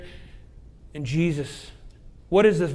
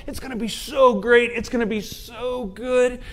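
high, gushing tempter; voice of sin; 7 semitones higher, 4 dB louder than usual. Acted. Your sin promises it will be great, high gushing voice. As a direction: oversell it, leaning hard on "so". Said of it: "Your sin goes".

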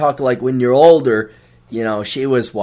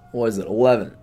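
ordinary talk

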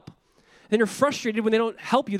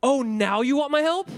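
mock-surprised rising "my help?", pitched up; 비꼬듯 "my help?" 끝을 올려 묻기; sarcastic question; 6 semitones higher than usual. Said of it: "God is saying this".

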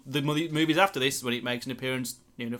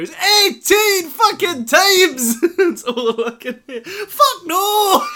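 high, loud manic Scottish yell, laughing; Robin Williams impression; 10 dB louder, much slower than usual. Co-host laughing as a mock Scottish Robin Williams, shouting. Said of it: "it's in fact Robin Williams".

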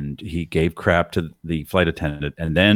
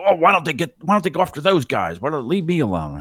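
raised, whiny imitation of a grumpy passenger; mock complaining man; 10 semitones higher than usual. Passenger complaining to his wife, whiny mock voice. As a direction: whine it like a put-upon guy. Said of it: "He's like".

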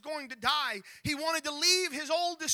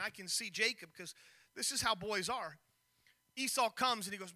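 normal talking voice

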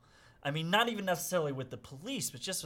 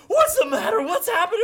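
imitating a panicked father, high and loud; alarmed dad; 10 dB louder than usual. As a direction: shout it in mock alarm, pitch way up. Alarmed dad runs out to son.

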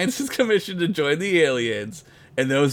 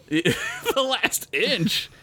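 slow, high laughing disbelief; 8 semitones higher, much slower than usual. Real, not acted.

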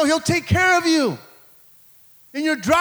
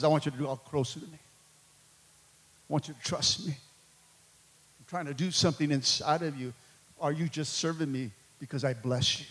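much lower and softer, a tender God; God's gentle voice; 12 semitones lower, 11 dB quieter than usual. Acted. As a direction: speak as God, low and hushed to his child. God answering, voiced by preacher.

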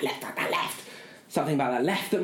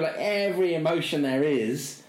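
usual speaking voice

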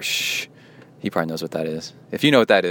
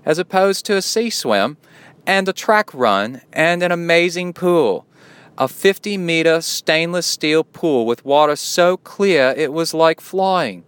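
a shaky mock British accent, pitched higher; 9 semitones higher, 5 dB louder than usual. Host reading a listener's email aloud in a mock British accent. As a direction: read it in an attempted British accent that slides toward Australian. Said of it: "I so bad want to do a British accent".

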